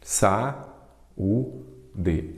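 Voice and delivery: "Sa, u, de" with clear gaps between syllables. Shown as a demonstration. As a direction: split saúde into three separate beats, Sa, u, de. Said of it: "Sempre dou esses exemplos também". ("Sa, u, de" dito sílaba por sílaba, com pausas)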